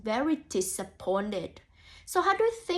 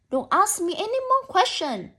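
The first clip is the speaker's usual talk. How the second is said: high, loud nervous rabbit snapping back; defensive rabbit; 7 semitones higher, 6 dB louder than usual. Acted. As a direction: push him off loudly, guilty and jumpy. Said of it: "The rabbit sounds very defensive".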